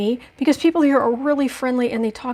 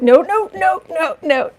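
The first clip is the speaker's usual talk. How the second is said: higher pleading no; retold plea to the dog, higher; mock no no no; 5 semitones higher, 5 dB louder than usual. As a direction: retell begging the dog to stop. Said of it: "I'm like".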